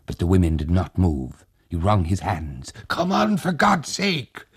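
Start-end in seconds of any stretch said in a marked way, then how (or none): 2.9-4.5 desperate plea. A narrator's reading. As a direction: beg them to move now.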